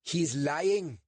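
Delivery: put-on a sharp German-accented accusation, pitched high. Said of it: "said a man in a German accent".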